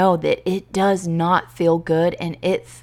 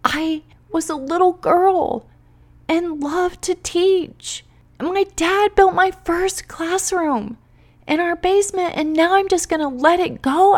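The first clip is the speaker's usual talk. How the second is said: put-on like a baby; 10 semitones higher than usual. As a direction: whine it out like a devastated little girl. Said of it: "I sound like a baby right now".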